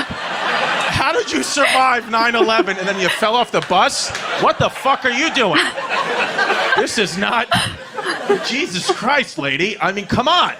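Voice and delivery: loud, high, incredulous scolding; laughing exasperated yell; exasperated rant. Retold or imitated. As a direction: rant at her in disbelief. Yelling at mom after the fall.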